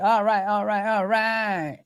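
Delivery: a laid-back, drawn-out "All right" in a mock Texas drawl, fading off; Matthew McConaughey. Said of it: "Like Matthew McConaughey".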